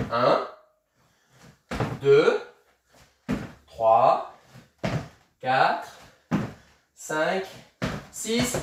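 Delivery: slow, drawn-out count with long gaps. Shown as a demonstration. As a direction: count it out slowly, calmly. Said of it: "On y va tranquille au début". (compter lentement, posément)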